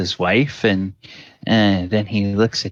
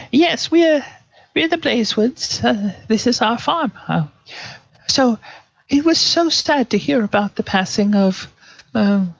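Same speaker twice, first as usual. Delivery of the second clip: much higher, chatty country wife; farm wife voice; 12 semitones higher than usual. Acted. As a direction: prattle sweetly like a farm wife.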